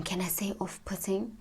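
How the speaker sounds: hushed, barely voiced aside to the listener; whispered aside. Real, not acted. Hushed aside querying own word.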